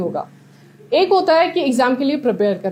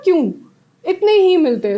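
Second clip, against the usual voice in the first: incredulous echo; 5 semitones higher than usual. Retold or imitated. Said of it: "मैं कहा".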